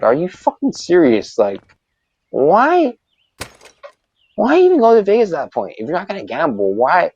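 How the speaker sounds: a retold, exasperated are-you-serious; incredulous. Retelling to a listener his disbelief at the guy, high and incredulous.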